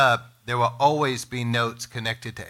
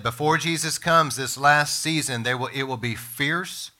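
normal talking voice

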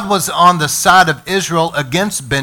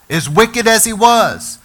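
high slow emphasis; 7 semitones higher than usual. Acted. Repeated for emphasis.

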